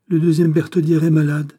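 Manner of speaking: high, loud exclamation of surprise; surprised. A narrator's reading. Governor looks up from the doctor's report, surprised. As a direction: read the news out in astonishment. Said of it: "dit-il avec surprise".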